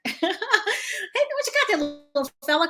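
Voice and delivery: put-on high voice for a little kid, through a laugh; acted, amused high-pitched hello; playful greeting. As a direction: chuckle while cooing to a child. Replays greeting to a boy, laughing.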